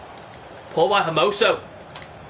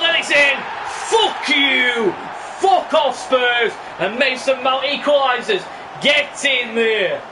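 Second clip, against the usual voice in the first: excited goal celebration; 6 semitones higher, slower than usual. Real, not acted. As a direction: roar the equaliser in triumph. Equaliser scored, celebrating.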